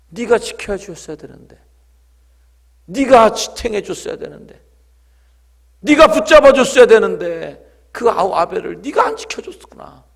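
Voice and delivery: high, building, emphatic reproach; God reproaching Cain. Retold or imitated. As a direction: press Cain harder with each line. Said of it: "하나님은 가인에게 물어보시는 거예요". (점점 강하게 책망하는 목소리)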